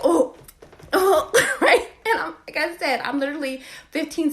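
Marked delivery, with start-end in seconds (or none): 0.0-1.9 high, louder moaning; mocking imitation of his moan. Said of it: "he's already like".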